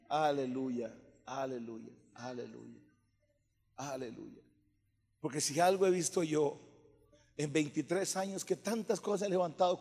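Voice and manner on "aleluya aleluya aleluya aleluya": low, soft repeated praise; murmured hallelujahs, fading; hushed worship. Real, not acted. Worshipping, feels the Lord here.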